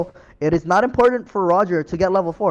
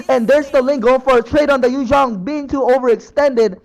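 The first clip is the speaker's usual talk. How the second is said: excited play-by-play; 5 semitones higher, 5 dB louder than usual. Real, not acted. Live play-by-play of teamfight.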